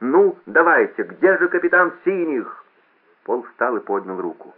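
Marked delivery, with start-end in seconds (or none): 0.0-2.6 slow, high, loud goading call; drawn-out mocking summons; sarcastic straining. A narrator's reading. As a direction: taunt the missing captain before a crowd. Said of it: "саркастически надрывался громкоговоритель".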